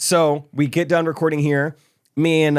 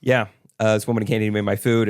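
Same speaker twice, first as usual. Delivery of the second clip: ordinary talk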